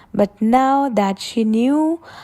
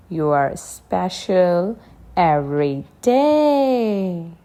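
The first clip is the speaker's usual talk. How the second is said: plain reading voice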